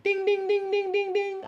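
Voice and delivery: high, loud ringing dings like a crossing bell; imitated crossing bell. Driver stuck at the crossing mimics the bell, high ringing dings. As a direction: ring it out like the railroad signal.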